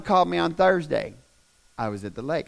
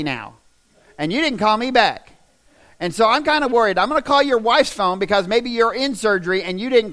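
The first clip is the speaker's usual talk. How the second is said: louder, higher imitation of a scolding mother; cross, worried mama; 6 semitones higher, 6 dB louder than usual. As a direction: act out mama's fretful voicemail, pushing each demand. Mama's worried, cross second voicemail.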